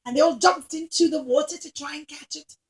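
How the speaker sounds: ordinary delivery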